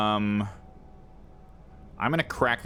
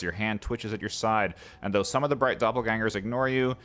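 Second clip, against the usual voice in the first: measured storyteller's read; steady narration for the game text; 4 semitones higher than usual. Acted. Reads game narration aloud.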